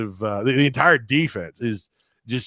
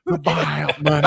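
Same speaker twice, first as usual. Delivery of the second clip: slow drawn-out "buyout money"; imitating Pittman. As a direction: drag out buyout money mockingly. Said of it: "Do that again".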